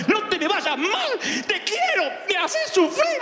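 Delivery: mother finding her lost son in the mall, scolding shrilly; frantic mother. Retold or imitated. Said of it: "Me pegaba y me abrazaba".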